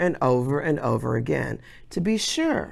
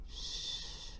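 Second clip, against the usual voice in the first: shushing; 23 dB quieter than usual. Acted. Teacher hushing class.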